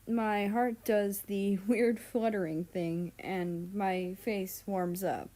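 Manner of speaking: ordinary delivery, nothing marked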